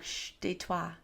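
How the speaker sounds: soft hushing "Shh, tais-toi"; mock shushing. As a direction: hush the stranger in a soft, playful voice. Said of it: "and I'm like".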